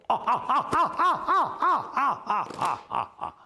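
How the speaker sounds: long high yawn while stretching; big stretching yawn. Acted. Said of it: "抻了个懒腰".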